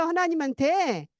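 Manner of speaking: normal talking voice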